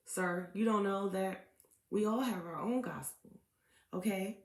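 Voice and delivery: ordinary delivery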